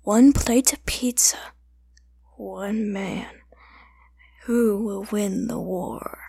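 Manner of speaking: slow, dramatic trailer whisper; movie trailer voice. Pretend. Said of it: "I could do a trailer voice".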